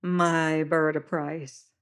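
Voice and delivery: smiling high-voiced seaman. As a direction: coax the stranger with a smile. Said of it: "smiling at me".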